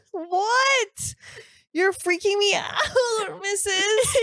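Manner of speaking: put-on high, cackling old witch voice. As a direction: screech it like a storybook witch, breaking into laughter. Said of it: "You sound like one of my friends in the thirteen hundreds who was a witch".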